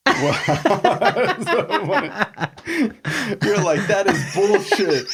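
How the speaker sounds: high, slow, amused mock outrage; laughing. Real, not acted. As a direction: laugh through the quote. Laughing retelling of a customer's outrage.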